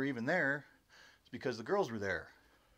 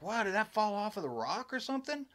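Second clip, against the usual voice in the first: higher-pitched startled question, retold; retold amazement; 8 semitones higher than usual. Storyteller asking friends where the rock came from, retold amazement. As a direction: act out being taken aback.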